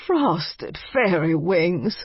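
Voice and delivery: put-on slow, wheezy old vampire voice; an elderly vampire muttering in surprise; wheezy old man. A narrator's reading. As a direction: drag the words out like a frail old man. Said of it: "uttered a wheezy male voice".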